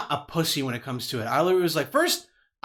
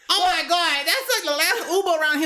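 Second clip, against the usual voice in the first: loud, high, hammed-up country lady; mock a country woman hollering with a twang; exaggerated country accent, pitched way up; 14 semitones higher, 5 dB louder than usual. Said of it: "trying to do, like, a little country accent".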